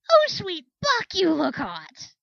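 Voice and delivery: a delighted gasp of appreciation, stretched long; excited exclamation. Acted. Flare gushing at Shining Mind's strappy saddle, thrilled and slow.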